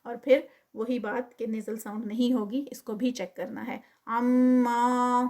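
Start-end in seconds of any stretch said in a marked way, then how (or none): 4.1-5.2 عما with ghunna first, then a clean two-count ما; tajweed recitation. Shown as a demonstration. Teacher models عما for students. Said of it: "عما اگر کر رہے ہیں ہم".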